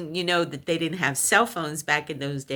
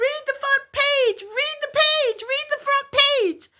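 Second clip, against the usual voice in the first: squealed frantic order, very high; imitated hysterical yelling; 4 dB louder than usual. Hysterical caller ordering her to the newspaper, imitated high yelling. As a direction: repeat it urgently at the top of your voice. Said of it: "he's hysterical on the phone".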